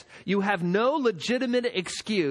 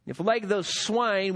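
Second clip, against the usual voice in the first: usual speaking voice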